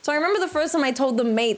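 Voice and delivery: ordinary talk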